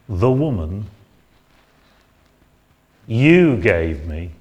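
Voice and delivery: mild mock resentment; resentful blaming. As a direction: throw the blame at God. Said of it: "That's the tone of voice which I think".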